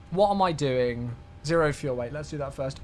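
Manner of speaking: ordinary delivery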